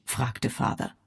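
ordinary delivery

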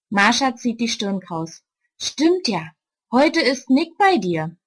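usual speaking voice

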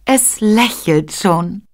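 langsam, höher und verzückt; selig. A narrator's reading. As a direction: die Mutter staunt glückselig über ihr Kind. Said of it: "sagte Maria selig". (selig und langsam, höhere Stimme)

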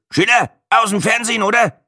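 put-on high, loud Franconian old man; Franconian grunt. Old cameraman recognises Schiller, in Franconian. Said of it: "grunzte ein offenbar fränkisches".

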